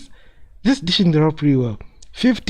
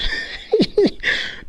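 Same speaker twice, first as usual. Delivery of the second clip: laugh. Real, not acted. Laughing at team's bad stats.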